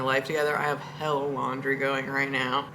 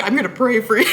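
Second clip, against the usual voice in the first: quoting the driver through laughter, higher and louder; laughing quote; 6 semitones higher, 7 dB louder, faster than usual. Retold or imitated. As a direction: laugh while repeating her words. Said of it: "she literally".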